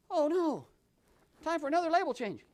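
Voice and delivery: usual speaking voice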